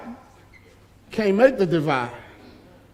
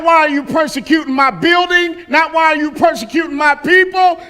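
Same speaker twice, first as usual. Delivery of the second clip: builds from raised to full shout; rising preacher shout; 5 semitones higher than usual. Retold or imitated. Preacher shouting the voice of Jesus to the congregation, building.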